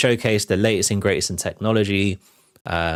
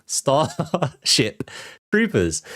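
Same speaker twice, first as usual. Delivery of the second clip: slow, careful, giggling through the words; laughing through it; 5 semitones higher, much slower than usual. Real, not acted. Vlogger finally gets the title out, laughing slowly. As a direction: spell the title out with a laugh in the middle. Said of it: "how can't I say it".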